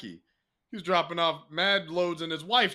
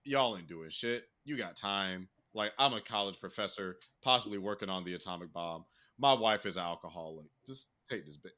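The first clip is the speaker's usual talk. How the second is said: lightly voiced Oppenheimer; 9 semitones lower, 4 dB quieter than usual. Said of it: "come with a baby said".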